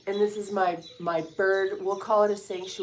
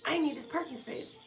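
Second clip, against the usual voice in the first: "I need this parking space" pitched higher as a whine; high, pleading whine; whiny plea; 9 semitones higher, 7 dB quieter than usual. Real, not acted. Repeats it, whining.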